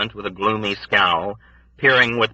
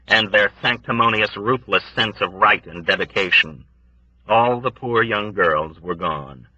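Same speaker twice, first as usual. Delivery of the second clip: plain reading voice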